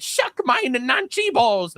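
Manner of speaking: high, drawn-out put-on German accent; mock German accent. Germany's imagined defiant answer, hammed-up German accent. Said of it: "what Germany should have said".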